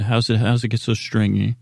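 ordinary talk

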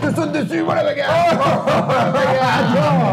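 high, goofy excited dog voice; silly dog voice. Acted. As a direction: play a hyper dog gleefully yelling about fighting. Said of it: "je refais le gogol". (voix de chien excité et idiot)

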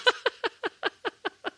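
high, helpless run of giggles; laughter. Real, not acted. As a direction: burst out laughing after a tease. Laughs after teasing her co-host.

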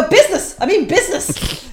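high, loud, urgent protest; mock insistent. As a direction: insist desperately to the officer. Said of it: "You're like".

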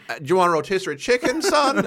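mock his dad asking a chirpy question; imitating dad. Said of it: "As my dad used to say".

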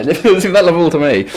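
high, quick laughing protest. Real, not acted. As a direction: laugh out the objection. Laughing objection.

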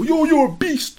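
high-pitched mock hype voice; admiring gym fan. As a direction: parody a fan gassing him up. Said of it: "I don't train for people to go".

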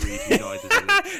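laughing through the words; high giggling delivery. Real, not acted. Joke obituary, cracking up.

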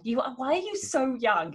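usual speaking voice

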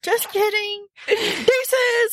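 high-pitched playful bail-out; hammed-up hasty goodbye; cheeky mock getaway. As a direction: call it high as if running off.

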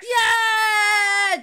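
cheering. Acted. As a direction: cheer her on from the crowd. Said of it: "Juna starts cheering".

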